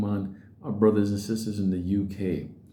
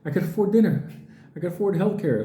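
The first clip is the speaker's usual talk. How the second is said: higher, relieved gushing; relieved Canadian; 6 semitones higher than usual. Pretend. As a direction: gush like a Canadian who finally breathes in Mexico.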